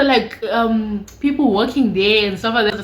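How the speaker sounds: ordinary delivery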